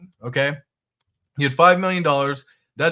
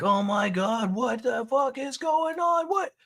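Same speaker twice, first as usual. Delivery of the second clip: imitating a panicking trader, much higher and slow; hammed-up shock, pitched way up; mock freaking out; 10 semitones higher, 4 dB quieter, slower than usual. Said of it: "You're just, like, freaking out".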